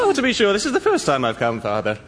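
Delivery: high-starting stage Irish lilt; Irish accent. Acted. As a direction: play the Irish son in a lilting accent. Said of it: "Copy that accent".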